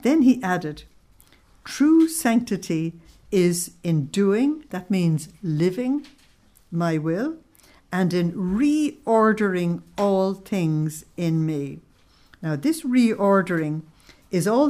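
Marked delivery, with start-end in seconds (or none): 1.6-4.6 each word slowed and weighted; slow, deliberate key line; slow, emphatic quote. Shown as a demonstration. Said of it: "put V I P on the next small bit". 6.7-11.8 drawn-out reading of the key phrase; slow, deliberate key line; slow, emphatic quote. Shown as a demonstration. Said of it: "put V I P on the next small bit".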